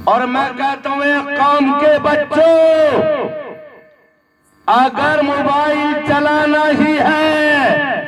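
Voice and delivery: high, drawn-out exhortation; full-force preaching. Acted. Preacher urges the community's youth to listen to recitation, full-force preaching. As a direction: exhort the crowd, stretching every phrase end.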